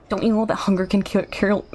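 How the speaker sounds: fast, tumbling delivery; rushed character line. Acted. Streamer voicing a meerkat, rushing the line to keep pace. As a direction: race through the line. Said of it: "trying to speak so fast for this guy's performance".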